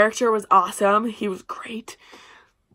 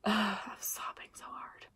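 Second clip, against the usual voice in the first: retold overwhelmed sigh; 17 dB quieter than usual. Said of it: "the last part, I was like".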